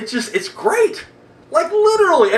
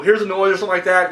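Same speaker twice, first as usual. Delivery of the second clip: normal talking voice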